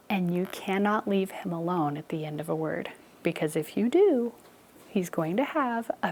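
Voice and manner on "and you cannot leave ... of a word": usual speaking voice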